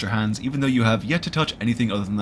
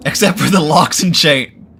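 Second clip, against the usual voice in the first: loud reading; 5 semitones higher, 9 dB louder, slower than usual. Real, not acted. Reads game text loudly.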